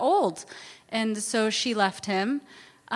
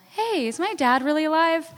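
higher, trying to sound offhand; forced casual; 6 semitones higher than usual. Retold or imitated. Asking her sister about their dad, forced casual. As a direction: ask it as if it were nothing. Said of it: "tried to make it sound casual".

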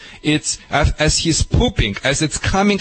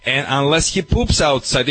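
normal talking voice

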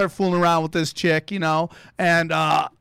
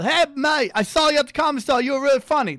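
put-on quick, high-pitched Australian accent; chirpy Aussie bloke doing his best Australian; 8 semitones higher, faster than usual. Comedian imitating the Australian guy at the bar, in an Australian accent. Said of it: "That was my Australian accent".

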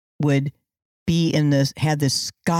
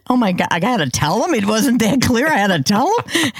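higher-pitched, embarrassed inner voice; hammed-up flustered realization; mock dismay; 6 semitones higher, 4 dB louder than usual. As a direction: imitate someone mortified at having to explain. Acting out the embarrassed comedian's reaction.

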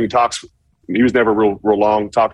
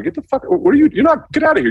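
furious yell at a rookie. Retold or imitated. Linebacker yelling at a rookie to leave the field, furious. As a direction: yell him off the field, outraged. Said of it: "I was like".

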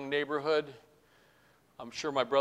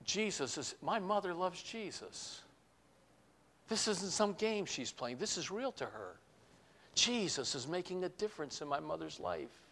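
softer, hushed inner voice; boy's inner thought; 3 semitones higher, 9 dB quieter than usual. Retold or imitated. Speaker remembering his boyhood thought in church, soft and hushed. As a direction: think it quietly, as a child in awe. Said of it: "consciously thinking this".

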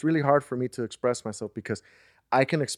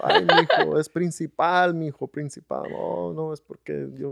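laughing lady quote; 6 semitones higher, 4 dB louder, slower than usual. Retold or imitated. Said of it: "She goes".

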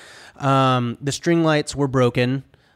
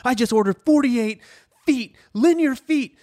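much higher, mock salesman excitement; hyped-up pitch; 13 semitones higher than usual. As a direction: oversell every fix to the guest. Hyped pitch of fixes to guest.

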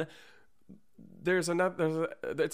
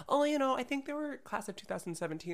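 put-on young fan voice; 14 semitones higher, faster than usual. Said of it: "and they'll say".

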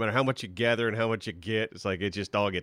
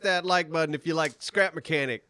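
youtuber outro voice; 7 semitones higher, 4 dB louder than usual. Pretend.

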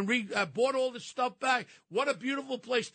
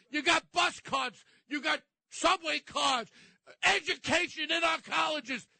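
upset rant; 6 semitones higher than usual. Real, not acted. Radio guest ranting about immigrants, real angry shouting. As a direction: let the anger boil over into a shout. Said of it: "I'm sorry for getting so upset".

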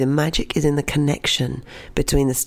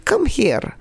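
high call to the cat; 10 semitones higher than usual. Retold or imitated. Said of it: "she shouted".

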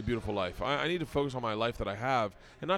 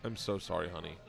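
softer, lower sympathetic voice; retold sad wife; 6 semitones lower, 7 dB quieter than usual. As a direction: gently imitate a sorry wife. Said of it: "she looks really sad".

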